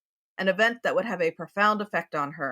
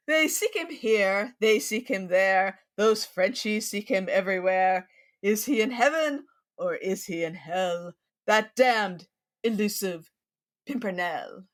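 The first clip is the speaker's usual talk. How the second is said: Leslie Howard impression. Podcast host reciting the Pimpernel poem, mild British accent. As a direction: recite it as a gentleman hero, lightly British. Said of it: "I will do my best Leslie Howard impersonation".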